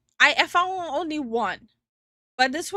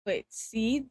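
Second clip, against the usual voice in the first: normal talking voice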